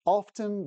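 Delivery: "Often" as "OFF-ten", high then falling; clear-T OFTEN. Shown as a demonstration. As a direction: pronounce the T in "Often" crisply. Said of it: "this is how I speak".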